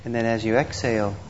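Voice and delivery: ordinary talk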